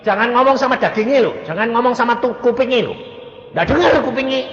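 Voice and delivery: high, loud insistent teaching; emphatic warning. Real, not acted. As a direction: warn the doctors firmly. Preacher warning doctors. (peringatan keras dengan suara tinggi)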